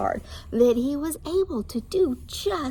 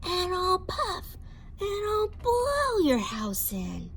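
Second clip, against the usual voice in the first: rising, higher wolf threat; wolf threat building higher; 9 semitones higher than usual. Acted. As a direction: build to the blow. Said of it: "the wolf said".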